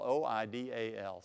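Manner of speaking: O I D A L spelled letter by letter; spelling it out. Shown as a demonstration. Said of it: "that's what goes in the second blank".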